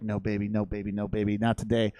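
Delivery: lightly voiced low brush-off; imitated weary refusal. Richard fending off the pestering bartender, low refusal. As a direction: repeat it low, putting her off. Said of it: "Richard's like".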